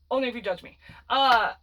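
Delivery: normal talking voice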